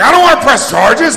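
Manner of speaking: high, loud mock panic; panicked retraction. Handcuffed man backing down to cops, high panicked yell. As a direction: yelp it as a man hastily backing down. Threatened man hastily backs down.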